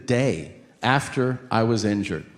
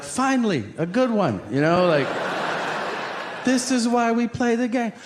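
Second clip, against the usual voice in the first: excited; 9 semitones higher than usual. Retold or imitated. Excited inner thoughts.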